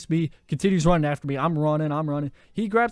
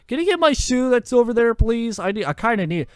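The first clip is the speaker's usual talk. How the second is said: high, louder pleading request; 8 semitones higher, 4 dB louder than usual. Retold or imitated. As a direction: beg sweetly for a favor. Begging classmate to fetch his shoe.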